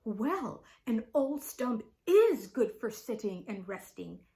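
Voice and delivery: loud, rallying declaration; straightening up. A narrator's reading. Old stump offers herself as a seat, rallying. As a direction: pull yourself up and announce it proudly. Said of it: "straightening herself up as much as she could".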